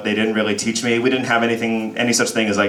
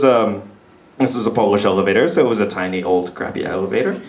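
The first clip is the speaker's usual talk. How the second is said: usual speaking voice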